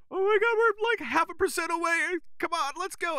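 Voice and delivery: very high-pitched imitated panic cheering; mock nerd screaming. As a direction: squeal like a gamer urging the team on. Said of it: "they were getting more vocal".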